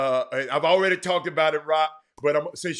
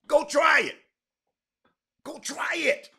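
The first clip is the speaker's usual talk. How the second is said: loud, high challenge to the audience; daring shout; 11 semitones higher, 3 dB louder than usual. Real, not acted. Host shouting a dare to viewers to test the bank. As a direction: fling the dare at them, go try it.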